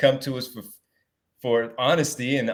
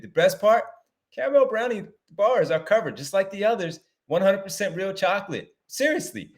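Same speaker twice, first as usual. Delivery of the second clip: upbeat, higher-pitched sponsor read; 5 semitones higher than usual. Acted. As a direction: sell it like a podcast host reading a sponsor spot. Reads a sponsor ad.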